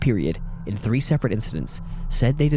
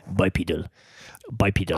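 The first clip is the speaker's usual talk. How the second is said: put-on accented tutorial voice, lower; lower, deadpan accented word demo; accented YouTube pronouncer; 5 semitones lower, 4 dB quieter than usual. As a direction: recite the word like an accented pronunciation video. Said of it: "talking about the YouTube guy".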